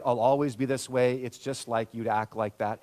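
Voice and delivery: the harsh self-talk of failure, pitched low; inner critic voice. Retold or imitated. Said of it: "we begin to believe".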